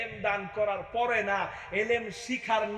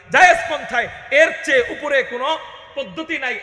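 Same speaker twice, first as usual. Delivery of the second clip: shouted, driving the point home; preaching shout; 4 semitones higher, 9 dB louder than usual. Acted. Preacher shouting the same line again to the crowd. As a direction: hammer the line at full voice.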